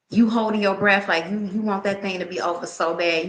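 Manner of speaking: normal talking voice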